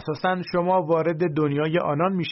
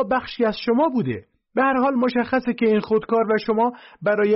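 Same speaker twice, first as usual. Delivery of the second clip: high, loud, breathless sales pitch; loud, high squeaky salesman yelling his deal; excited shout; 7 semitones higher, 4 dB louder than usual. A narrator's reading. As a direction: shout the pitch like a cornered hustler. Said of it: "فوراً ... فریاد زد".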